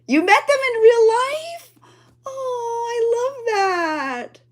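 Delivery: tearful high cry, slow on the last words. Real, not acted. Vlogger reacts to a viewer meeting the cast, crying. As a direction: voice breaking into tears of joy. Crying on hearing they met.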